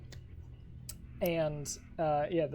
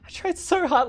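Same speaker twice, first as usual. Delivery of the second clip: high, loud hammed-up wail of a nurse; mock crying nurse; 13 semitones higher, 11 dB louder than usual. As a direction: whimper it like a useless nurse. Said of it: "just like".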